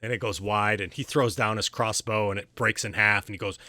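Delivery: ordinary talk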